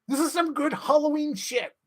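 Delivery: very high, squeaky delight; high-pitched gleeful outburst; giddy excitement. Real, not acted. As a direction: squeal it, thrilled with your own idea. Thrilled at own story idea.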